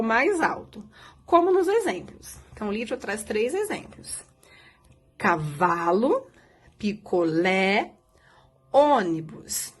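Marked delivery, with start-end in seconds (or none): 5.2-9.7 slow, lower-pitched, each word drawn out; slow word modelling. Shown as a demonstration. Teacher gives pupils three example words, slow and drawn out. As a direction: read each word slowly with a pause after it.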